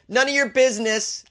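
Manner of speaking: high, defiant snap back; defiant retort. Acted. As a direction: shut down the question sharply. Snapping back at employer.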